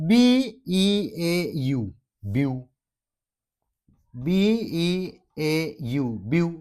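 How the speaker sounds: বি ই এ ইউ letter by letter, then joined as বিউ; spelled by syllable. Shown as a demonstration. Teacher showing students how the syllable rule works, spelled out piece by piece. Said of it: "চিলেবুলৰ সূত্ৰটো ইয়াত প্ৰয়োগ".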